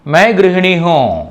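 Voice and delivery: మై గృహిణి హో spoken slowly and clearly; unhurried teacher demo of మై గృహిణి హో; slow model sentence. Shown as a demonstration. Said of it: "చదివేటప్పుడు గృహిణి అని చదువుతాం".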